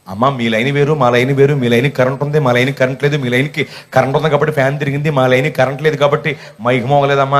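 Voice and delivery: low-pitched patient technical explanation, lightly acted; posing as lineman. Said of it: "పెద్ద line మెన్లాగా అవతారం ఎత్తి చెప్పాను".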